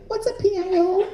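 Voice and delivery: high, clueless little voice asking; high naive question, laughing; clueless rookie. Retold or imitated. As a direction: play dumb newbie with a laugh. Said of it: "I go".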